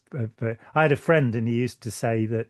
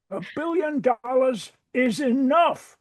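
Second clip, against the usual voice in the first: high, slow, hammed-up American politician; Bernie; 12 semitones higher, slower than usual. His impression for her of Bernie, high and slow. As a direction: drag it out in a high put-on American voice. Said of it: "you've got Bernie, of course".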